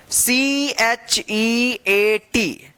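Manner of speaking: C H E A T drawn out by letter; slow spelling. Shown as a demonstration. Teacher spells for learners, C H E A T stretched slowly.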